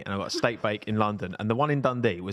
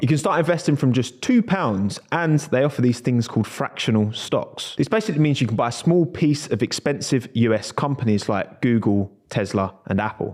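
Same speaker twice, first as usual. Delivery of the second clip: upbeat sponsor read, a bit louder; 6 dB louder than usual. Acted. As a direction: sell it like a podcast ad. Reads sponsor ad.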